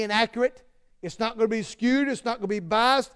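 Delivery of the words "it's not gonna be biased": usual speaking voice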